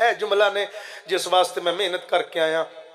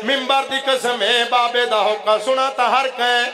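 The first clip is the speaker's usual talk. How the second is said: full-force preaching; 4 semitones higher than usual. Acted. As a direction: drive the majlis to its peak. (ਪੂਰੇ ਜੋਸ਼ ਨਾਲ ਜ਼ਾਕਰੀ)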